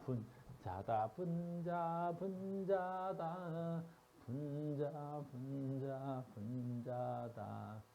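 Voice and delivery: slow, deliberate repetition; slow drawn-out dictation. Shown as a demonstration. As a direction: stretch each syllable very slowly. Spelling out a term for students.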